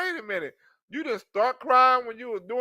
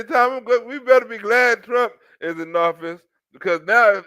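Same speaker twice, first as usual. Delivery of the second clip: usual speaking voice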